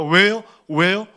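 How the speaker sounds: demanding protest. Pretend. Joseph demanding answers.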